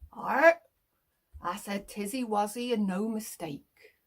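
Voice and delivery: a light West Country farmer's burr; cheery rural farmer, lightly voiced; country farmer accent. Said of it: "he liked to say things like".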